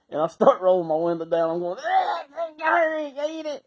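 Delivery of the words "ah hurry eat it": very high, strained gagging cry; frantic disgust. Retold or imitated. Telling his buddy to hurry eating, frantic revolted wail. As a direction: plead through a retch, hammed-up. Retelling his panic at the smell.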